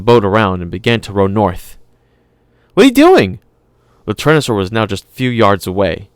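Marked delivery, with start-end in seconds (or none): 2.7-3.4 put-on high, loud little girl voice; a stubborn kid talking back; girl assuring her brother, voiced high; young girl. Lex voiced in alarm.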